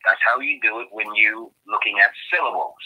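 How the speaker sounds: ordinary talk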